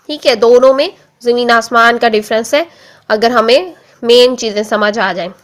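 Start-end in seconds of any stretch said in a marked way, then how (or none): none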